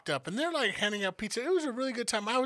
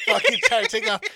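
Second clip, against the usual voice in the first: much higher, loud laughing words; 10 dB louder than usual. Real, not acted. Telling about the drunk brother running off, laughing high.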